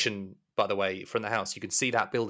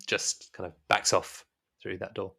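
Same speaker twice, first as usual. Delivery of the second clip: normal talking voice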